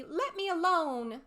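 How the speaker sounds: put-on high, slow girl's plea; Alice, upset. A narrator's reading. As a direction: push the bird away with your voice. Alice pushing the pigeon away.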